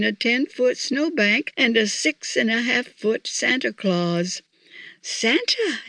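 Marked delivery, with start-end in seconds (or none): 5.0-5.8 high-pitched call of his name; exclaimed call. A narrator's reading. Missus Claus calling Santa to breakfast, exclaiming. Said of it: "exclaimed Missus Claus".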